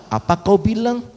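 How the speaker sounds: put-on high Batak-accented challenge. Maria answering the angel as if Batak, in Batak accent. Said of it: "kalau dia orang Batak begitu ya".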